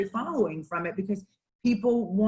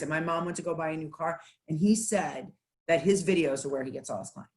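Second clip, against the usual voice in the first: normal talking voice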